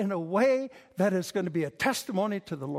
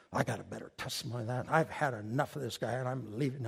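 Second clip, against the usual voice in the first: low, soft, quick grumble; grumbling to himself; 8 semitones lower, 5 dB quieter, faster than usual. Acted. Preacher replays his thought in the meeting, low grumble. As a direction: grumble low under your breath, fed up.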